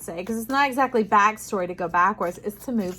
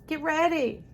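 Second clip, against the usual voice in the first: ordinary talk, nothing marked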